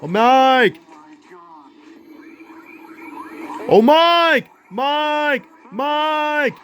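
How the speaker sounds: high, drawn-out call for someone missing. Acted. Searchers calling for their lost friend, calling out. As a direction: holler the name out high and long.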